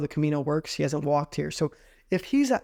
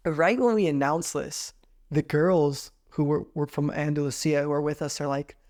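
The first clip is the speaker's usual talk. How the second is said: normal talking voice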